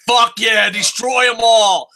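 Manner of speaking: mock ringside roar; retold cheering shout. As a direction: yell it like a fan ringside. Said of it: "and I'm like".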